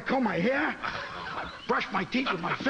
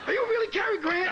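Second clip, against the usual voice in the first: high, giddy fan question; starstruck; 10 semitones higher than usual. Retold or imitated. Starstruck fan asks star.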